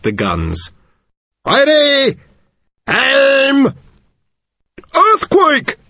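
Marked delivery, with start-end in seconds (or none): 1.5-5.8 high, loud yelled commands; shouted orders to a firing squad; yelling. Acted. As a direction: bark out the firing orders, then the trick word. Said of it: "he yelled".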